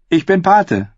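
put-on eager young novice, voice pushed up; doughy youth voiced. The pale novice offers Sam a cell, in a high boyish voice.

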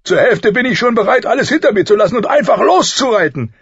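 high, loud, eager royal voice; exuberant king. A narrator's reading. King dreaming of riding away, exuberant. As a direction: blurt it out boldly.

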